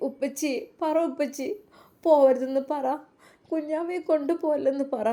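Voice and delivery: put-on child pleading with her father not to let the baby go; sobbing little girl. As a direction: beg your father like a tearful little girl. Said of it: "ഐഷുവിൻ്റെ തേങ്ങൽ".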